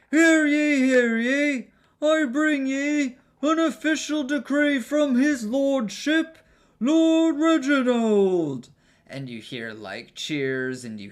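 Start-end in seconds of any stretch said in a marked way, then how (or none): 0.1-8.7 very high, loud, drawn-out foppish herald; town crier. Acted. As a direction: proclaim a decree to the whole square. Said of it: "So he says".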